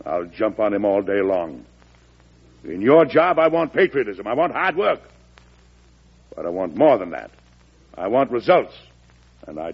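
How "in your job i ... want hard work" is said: louder and higher, laying down the law; stern raised demand; forceful. Acted. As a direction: drive it home to the man. President dressing down his secretary.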